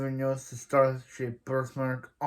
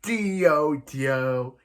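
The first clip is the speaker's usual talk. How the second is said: dramatic name call; 4 dB louder than usual. Retold or imitated.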